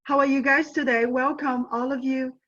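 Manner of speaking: normal talking voice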